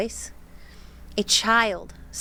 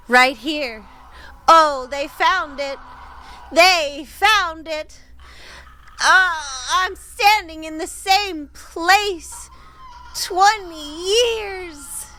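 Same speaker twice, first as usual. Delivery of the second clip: louder and higher, dragged out slowly; eerie rising voice; 7 semitones higher, 5 dB louder, much slower than usual. Retold or imitated. Voicing the grandfather's ghost, growing loud, high and slow. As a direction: build up into a haunted wail.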